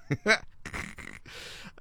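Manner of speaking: brief breathy laugh; real stifled laugh. Real, not acted. Host reading the ministry's reply about the invitation, laughing briefly. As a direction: break off into a short, voiceless laugh.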